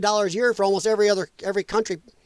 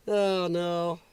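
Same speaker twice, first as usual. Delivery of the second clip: ordinary talk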